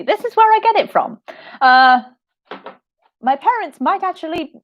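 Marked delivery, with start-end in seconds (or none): 0.0-1.2 quick and much higher; high fast realization. Real, not acted. Realizing she gets her sass from her mom, high and quick.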